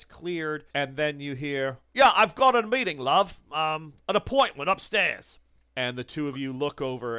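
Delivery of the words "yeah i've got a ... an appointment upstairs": loud, high cheery cockney bloke; Sid's voice. Acted. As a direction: call it out breezily to the desk. GM voices Sid arriving.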